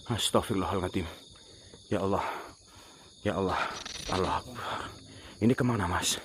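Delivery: low, soft murmuring; hushed, shaken prayer. Real, not acted. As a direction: keep it under your breath after a scare. Shaken after the scare. (lirih setelah kaget)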